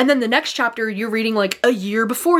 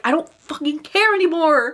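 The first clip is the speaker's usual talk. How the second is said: higher, exasperated burst of frustration; heated outburst; 6 semitones higher than usual. Retold or imitated. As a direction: snap it out like you're fed up. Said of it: "I'm getting heated all over again".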